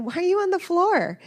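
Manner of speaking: put-on high, loud little-kid voice; child's question. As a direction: ask it like a puzzled toddler. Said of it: "they said".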